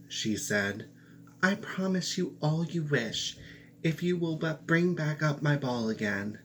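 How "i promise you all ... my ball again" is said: a high, sweet princess promising. Acted.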